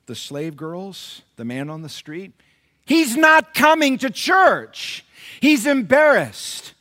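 loud, high, slow pulpit cry with a sob; impassioned preaching. Acted. Pastor pleading for the man on the street, tearful full-force preaching. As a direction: pour out the plea at full force. Preaching climax about the homeless.

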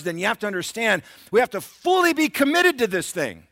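loud, high, driving sermon delivery; impassioned preaching. Real, not acted. Pastor urging church to be fully committed, impassioned preaching. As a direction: drive each phrase home, building to a shout.

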